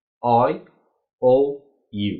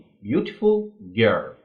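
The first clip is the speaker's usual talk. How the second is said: slow example; 2 semitones lower, much slower than usual. Shown as a demonstration. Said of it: "هحط مثلًا هقول إيه".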